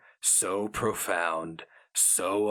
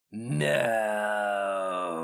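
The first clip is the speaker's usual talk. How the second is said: icy, rasping inhuman voice. A narrator's reading. The Inkoroi contradicts Sheo Narnra, inhuman rasped refusal. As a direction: cut him off like ice on skin. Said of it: "The glistening Inkoroi rasped".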